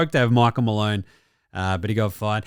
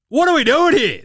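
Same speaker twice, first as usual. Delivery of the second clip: exasperated outburst; 11 semitones higher, 5 dB louder than usual. Real, not acted. Ranting at refs.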